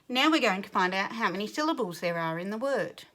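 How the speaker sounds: ordinary talk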